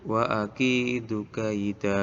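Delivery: slow, drawn-out recitation; stretched vowels, teacher's demonstration; Quranic recitation. Shown as a demonstration. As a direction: recite each syllable long and measured. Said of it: "Nih lafalnya".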